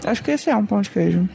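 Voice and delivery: talking through a mouthful; words round a bite of food; mouth full. Real, not acted. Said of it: "Eu tô falando com a boca cheia".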